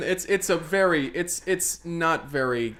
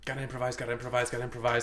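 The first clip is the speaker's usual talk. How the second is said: rapid low-key repeated chant; frantic self-talk; 3 semitones lower, 6 dB quieter, much faster than usual. Acted. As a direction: rattle it off fast under your breath. Chef panicking over what to cook.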